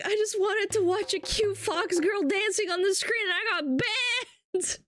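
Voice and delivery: imitating banned viewer. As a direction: play the poor drunk guy protesting innocence. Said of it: "He's like".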